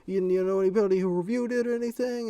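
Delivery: put-on high, loud young customer voice; imitated kid. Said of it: "I didn't believe this kid at all".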